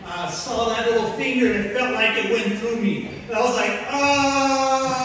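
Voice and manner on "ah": loud shocked gasp; stunned loud ah; startled gasp. Retold or imitated. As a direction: cry out as if pierced. Struck by the finger.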